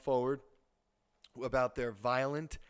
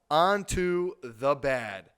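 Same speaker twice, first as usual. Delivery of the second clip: loud, slow, drawn-out announcer line; punchy, stretched-out transition; segment announcement; 2 semitones higher, 10 dB louder, much slower than usual. Real, not acted. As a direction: announce it big, word by word. Host announces next segment.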